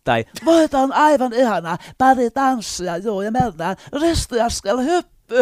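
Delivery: shrieking with delight at a dance show; flamboyant judge. Shown as a demonstration. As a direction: gush over the dancers, high and thrilled. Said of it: "dance show judge voices".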